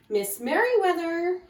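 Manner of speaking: "Merriweather" called out high; urgent call for the librarian. A narrator's reading. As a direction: call her name down the hall. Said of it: "he called".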